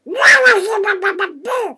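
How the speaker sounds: loud burst of duck squawking; rapid squawked gibberish; duck babble. Acted. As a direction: babble wildly like a quacking duck.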